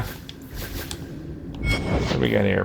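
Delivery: soft whispered aside to self. Real, not acted. Player browsing loot, a private whisper. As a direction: breathe it out under the breath. Muttering over loot menu.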